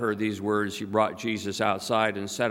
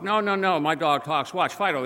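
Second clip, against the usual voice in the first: high-pitched, eager insisting; comic raised-pitch protest; insistent protest; 5 semitones higher than usual. Acted. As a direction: act the dog owner pleading to be believed. Dog owner insists in retold joke.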